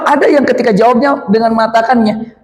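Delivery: ordinary delivery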